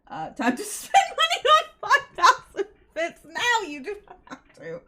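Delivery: loud laughing joke, high. Real, not acted. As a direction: cackle as you tease them to spend. Joking about spending money.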